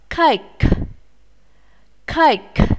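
Cake with a strong puff of air on the final K; aspirated ending. Shown as a demonstration. Teacher models Cake for pupils, final K aspirated. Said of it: "phải bật hơi ra".

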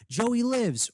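high-pitched calling out, no real volume; mock shout. A narrator's reading. Boy shouts that another boy lives, a high restrained shout. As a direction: call it out high, like a held-back shout. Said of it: "someone will shout".

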